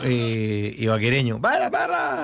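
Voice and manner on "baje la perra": put-on high shout in an Ibagué accent. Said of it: "con ese acento, eh, ibaguereño".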